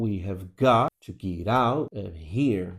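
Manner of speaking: slow "We have got to get out of here", beats on GOT, OUT, HERE; three stressed pulses. Shown as a demonstration. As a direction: punch "got", "out" and "here" slowly, reduce the rest. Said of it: "Três pulsos".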